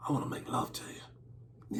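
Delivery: hushed bedroom whisper; seductive whisper. Shown as a demonstration. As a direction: whisper it like a lover. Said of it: "You see how that sounds?".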